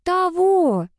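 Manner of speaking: high-pitched teasing mimicry; mocking echo. A narrator's reading. Sister mimicking her brother's question, mocking. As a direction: parrot him in a drawn-out high voice. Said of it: "передразнила я брата".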